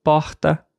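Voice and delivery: porta with a breathy back-of-throat r, like "pohta"; "porta" with aspirated throat r; guttural R. Shown as a demonstration. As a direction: say "porta" with an h-like r before the t. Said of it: "pronunciada de um jeito diferente".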